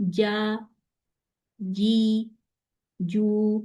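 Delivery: "جا، جی، جو" as held, level syllables; careful model of "جا، جی، جو"; jeem with vowels. Shown as a demonstration. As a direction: sound each voiced j cleanly with a long vowel. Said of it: "اس کو sound نکالتے ہیں".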